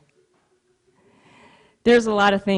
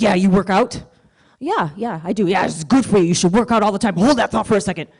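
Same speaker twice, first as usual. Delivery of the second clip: gym bro; 2 semitones higher, 5 dB louder than usual. Retold or imitated. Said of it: "this is what he sounded like".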